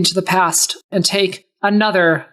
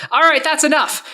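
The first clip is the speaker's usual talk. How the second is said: exasperated; 7 semitones higher than usual. Acted. Soren loses patience.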